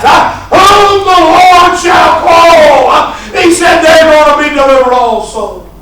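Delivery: shouted, high, rhythmic preaching with "uh" breaths; full-force preaching. Acted. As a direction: preach it out at the top of the voice.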